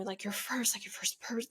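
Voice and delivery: hushed, breathy confiding whisper. Real, not acted. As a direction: drop to a whisper as if sharing a secret. Confiding about first love.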